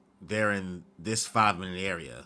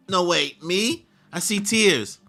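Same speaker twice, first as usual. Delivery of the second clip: high, louder disbelief; surprised protest; 8 semitones higher, 5 dB louder, slower than usual. Real, not acted. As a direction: squeal it in surprise. Reacting to own character crying.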